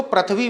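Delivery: "पृथ्वी" said prath-vee, with ra; common mispronunciation. Shown as a demonstration. Teacher quoting how many people say it, "पृथ्वी" as prathvi. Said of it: "बहुत से लोग इसको पृथ्वी बोलेंगे".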